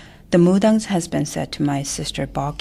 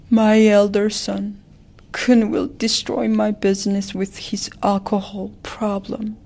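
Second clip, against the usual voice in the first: crying; 5 semitones higher than usual. Acted. Shaman channels the father grieving over his son, tearful. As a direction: cry out the father's disappointment. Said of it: "She started crying and said".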